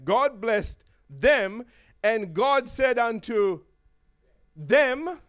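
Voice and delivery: them drawn out slowly and hit hard; stress on them. Shown as a demonstration. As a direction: lean slowly on every them. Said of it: "emphasis on purpose".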